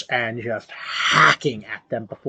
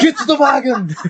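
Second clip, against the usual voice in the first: a high, loud mock Arnold line, laughing; Arnold parody; 13 semitones higher, 6 dB louder, faster than usual. GM riffing on the Arnold joke, a loud mock Arnold bark. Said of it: "too much Arnold".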